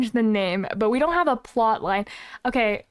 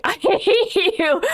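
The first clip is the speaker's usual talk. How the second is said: laughing; 7 semitones higher, 4 dB louder, slower than usual. Real, not acted. Joking at donut teaser.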